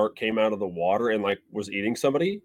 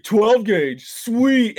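high, pleased dude; a hint of a dim teen admiring his gun; mock dude voice; 8 semitones higher, 3 dB louder, much slower than usual. Mocking a movie teen showing off a shotgun, lightly voiced.

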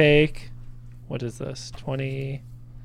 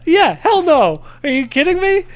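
high, loud, gleeful exclaim; excited outburst; 13 semitones higher, 5 dB louder than usual. Real, not acted. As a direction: gush with delight. Excited player reacting.

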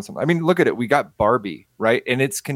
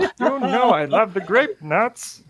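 Skeletor voice; 7 semitones higher, slower than usual. Acted. Skeletor roommate bit.

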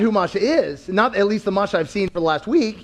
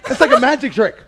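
laughing; 5 semitones higher, 6 dB louder, faster than usual. Real, not acted. Praising wife, laughing.

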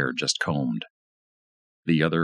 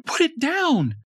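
much higher, louder woman's scream; wife screaming as he grabs knife; 4 dB louder, slower than usual. A narrator's reading. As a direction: scream at him to stop. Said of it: "She screamed".

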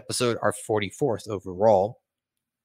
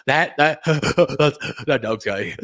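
laughing. Real, not acted.

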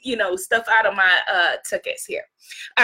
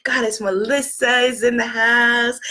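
usual speaking voice

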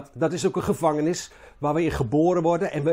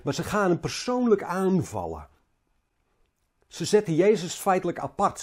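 ordinary talk, nothing marked

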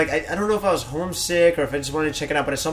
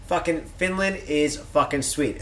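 ordinary delivery

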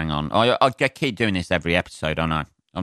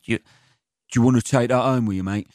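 lightly put-on New York accent, a bit higher; 4 semitones higher than usual. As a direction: attempt a New York waiter. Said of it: "in your best New York accent there".

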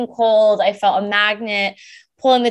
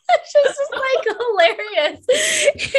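much higher, still laughing through the words; 14 semitones higher, slower than usual. Real, not acted. Telling the host how funny it was, real laughter. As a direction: talk through the giggles, slowly.

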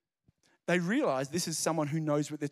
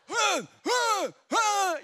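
high laugh; 6 dB louder than usual. Real, not acted. Joke about Scots.

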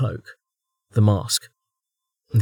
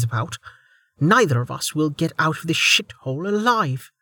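higher, furious hushed rant; angry woman; 8 semitones higher than usual. Acted. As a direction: vent her frustration.

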